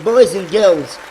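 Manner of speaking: usual speaking voice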